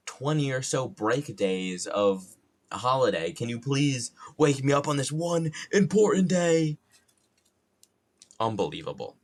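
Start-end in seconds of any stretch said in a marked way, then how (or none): 3.3-6.8 high, loud, dramatic pleading; exasperated mock plea. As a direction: whine upward in mock outrage.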